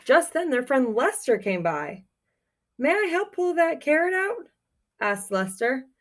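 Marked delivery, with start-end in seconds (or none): 2.8-4.5 high little squeaky mouse; small mouse voice. Acted. Lester the mouse politely asks to help, high and small. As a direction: play the tiny hopeful mouse asking.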